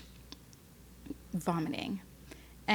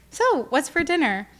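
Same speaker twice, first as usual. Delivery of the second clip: lightly imitating an oblivious husband, pitched up; innocent question; 7 semitones higher, 4 dB louder than usual. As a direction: ask it innocently in a raised voice. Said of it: "so innocently was just like".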